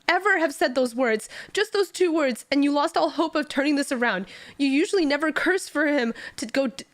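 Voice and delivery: high, anguished reproach; bitter lament. Acted. Subordinate bitterly reproaching his master, high anguish. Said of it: "Jen Yu cries bitterly".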